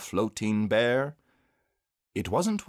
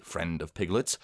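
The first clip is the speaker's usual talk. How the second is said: ordinary delivery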